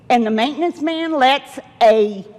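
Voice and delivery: usual speaking voice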